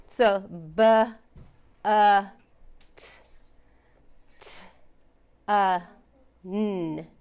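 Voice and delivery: "b, uh, t, t, uh, n" said sound by sound with pauses. Shown as a demonstration. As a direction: break the word into separate sounds. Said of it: "let's sound it out".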